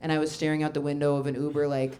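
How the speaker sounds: normal talking voice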